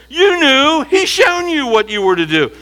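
high, loud, insistent preaching; preacher rising to full force; fiery preaching. Acted. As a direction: drive the point home to the congregation.

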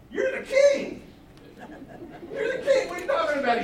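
wife mocking her husband's weakness, high and loud; incredulous wife. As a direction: laugh him off in disbelief.

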